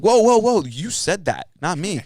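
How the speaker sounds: high, loud whoa, whoa, whoa, then settling; startled shout that calms down; mock alarmed backpedal. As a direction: throw your hands up, not my words. Quoting himself backing off.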